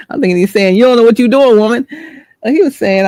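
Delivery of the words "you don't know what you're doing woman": imagined scolding man. Retold or imitated.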